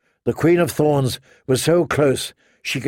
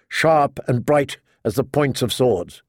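ordinary delivery

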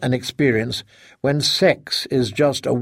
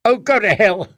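higher, louder furious outburst; 6 semitones higher, 3 dB louder than usual. A narrator's reading. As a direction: spit it at him in fury. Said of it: "Helen said furiously".

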